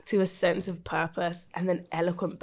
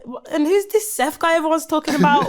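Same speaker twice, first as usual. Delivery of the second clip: high, loud, baffled questions; bewildered; 8 semitones higher, 6 dB louder than usual. Retold or imitated. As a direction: ask it half lost, half amused. Retold bewildered thoughts.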